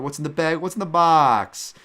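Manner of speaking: high, loud mock-desperate demand; overacted pleading shout; hammed-up cry. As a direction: cry it out, stretching the end. Acts out a quoted line.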